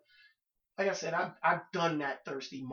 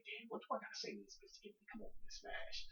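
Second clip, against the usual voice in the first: hushed; 7 semitones higher, 14 dB quieter, faster than usual. Retold or imitated. Inner thought in thirsty mode.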